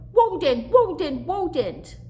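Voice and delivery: put-on high, loud baby chant of woadent; toddler echoing. Baby brother echoing his sister, high and loud. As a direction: echo the big sister with glee. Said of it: "Bubba echoed".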